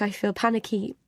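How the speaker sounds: ordinary delivery, nothing marked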